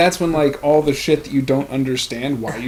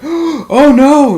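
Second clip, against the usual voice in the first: mom's gasp; 12 semitones higher, 10 dB louder than usual. Retold or imitated. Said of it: "she's like, gasps".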